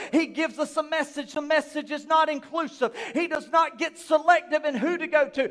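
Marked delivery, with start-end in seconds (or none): none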